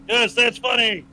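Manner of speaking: ordinary talk, nothing marked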